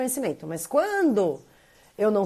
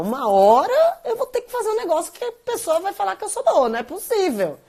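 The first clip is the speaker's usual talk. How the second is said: high, laughing exasperation; pitched up and half laughing at herself; a laughing plea for praise, high and fast; exasperated inner voice; 9 semitones higher, 4 dB louder than usual. Retold or imitated. Voicing her own desperate inner thought.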